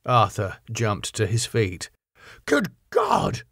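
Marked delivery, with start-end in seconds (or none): 2.5-3.4 sudden high shocked cry; cried out. A narrator's reading. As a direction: gasp it out in alarm, pitched high. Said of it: "he cried".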